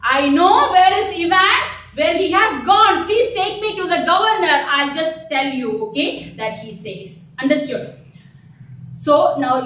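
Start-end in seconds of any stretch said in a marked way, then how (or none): 0.0-5.7 urgent plea. Retold or imitated. Teacher voicing McLaren's urgent plea to the officers. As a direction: beg to be taken to the governor. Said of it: "And McLaren said that".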